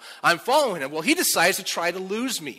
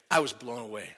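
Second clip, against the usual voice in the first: normal talking voice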